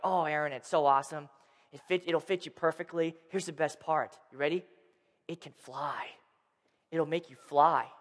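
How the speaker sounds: low, hushed brother sharing a secret; soft bedtime sales pitch; hushed confiding. Retold or imitated. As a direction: build wonder quietly, barely above a murmur.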